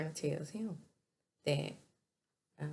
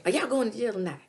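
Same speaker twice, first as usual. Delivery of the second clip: high, loud incredulous ask; loud mock question; 7 semitones higher, 8 dB louder, faster than usual. [name] asking Sabrina loudly about jail.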